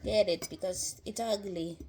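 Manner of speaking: playful put-on Italian accent. Cook getting the carrot ready to cut, in an Italian accent. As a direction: play an Italian cook at work. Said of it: "Forgive my Italian accent".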